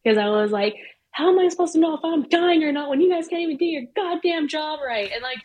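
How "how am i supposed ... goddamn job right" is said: high, loud retold rant; losing it. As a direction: let the anger boil over at the nurse. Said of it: "I, like, lost my shit".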